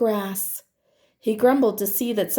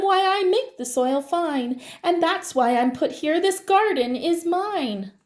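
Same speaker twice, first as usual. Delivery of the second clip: high worm voice; 7 semitones higher than usual. Acted. Worm claims garden.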